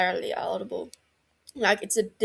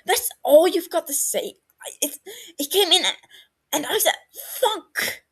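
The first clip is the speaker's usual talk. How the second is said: high, loud exasperated outburst; exasperated rant; 10 semitones higher, 4 dB louder than usual. A narrator's reading. Regulus ranting at Barty about his reply. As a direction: throw it at him in disbelief.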